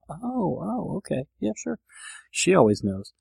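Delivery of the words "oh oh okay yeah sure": high, small, soft reply; meek agreement. Acted. Husband answering his all-knowing wife, meek agreement. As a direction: give in mildly, light and high.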